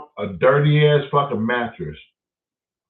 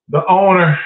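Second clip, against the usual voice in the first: firm announcement; 4 semitones higher, 6 dB louder than usual. Retold or imitated. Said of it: "Y'all heard me say this on other houses".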